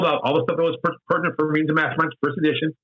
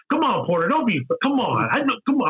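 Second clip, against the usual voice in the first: higher-pitched goading, daring him to swing; taunting challenge; 9 semitones higher than usual. Retold or imitated. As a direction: egg him on to throw a punch. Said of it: "He's like".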